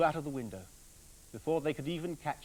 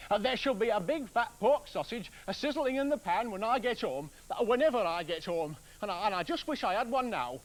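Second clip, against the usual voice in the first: ranting woodcutter; 7 semitones higher, 5 dB louder than usual. Acted. Woodcutter rants, wishes sausage.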